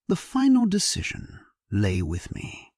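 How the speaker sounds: ordinary delivery, nothing marked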